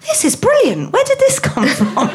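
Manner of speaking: high, surprised husband's exclamation; mock-amazed, pitched well up; belated delight. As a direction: imitate someone suddenly delighted by a find.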